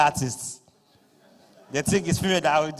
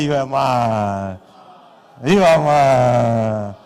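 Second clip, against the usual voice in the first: drawn out, louder, lower; slow call and response; 4 semitones lower, 8 dB louder, much slower than usual. Acted. Emcee leading the crowd to tell the neighbor slowly. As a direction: stretch each word to the neighbor. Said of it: "Look at the neighbor, say".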